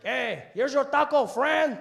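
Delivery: high, slow hearty holler; taco truck vendor. Retold or imitated. Taco seller handing over the food, loud drawn-out call. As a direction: bellow it out to the customer. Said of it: "and he's like".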